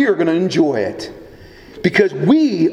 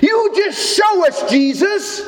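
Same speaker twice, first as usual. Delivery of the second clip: jeering crowd voice, higher; mocking taunt; 9 semitones higher than usual. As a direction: taunt him like a scornful mob. Mockers taunting Jesus on cross.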